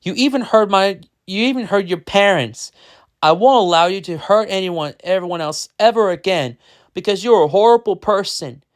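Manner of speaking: high, forceful story shout. A narrator's reading. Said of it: "he shouted".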